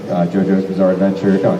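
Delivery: usual speaking voice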